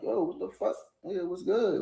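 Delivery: acting out being smacked out of sleep; startled protest. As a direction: caught off guard, voice jumping up.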